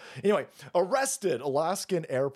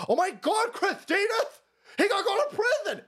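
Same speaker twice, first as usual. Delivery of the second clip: high squealing pearl-clutch; mock shocked liberal. Comic mocking a liberal, high shocked squeal. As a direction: gasp it out in horror.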